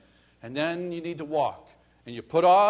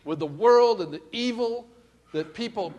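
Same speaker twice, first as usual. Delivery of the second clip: ordinary talk, nothing marked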